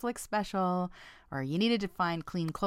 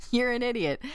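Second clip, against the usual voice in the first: higher, pointed insult; really meaning it; 5 semitones higher than usual. Acted. Coworker insulting colleague over forgotten keys, meaning it. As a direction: say it as if you truly mean it. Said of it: "if they really meant it".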